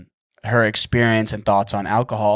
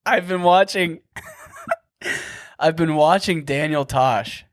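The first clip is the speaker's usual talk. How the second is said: higher, laughing through the words; real amusement bubbling up mid-sentence; 5 semitones higher than usual. Real, not acted. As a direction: break into high giggles while talking. Amused at catching own impression.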